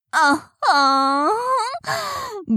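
slow, high, drawn-out sultry moan; hammed-up sexy moan. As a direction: stretch it out like a put-on seductive sigh. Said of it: "色っぽく".

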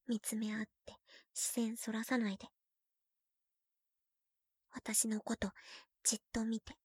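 soft close whisper; soft intimate whisper to senpai. Acted. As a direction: draw him in quietly.

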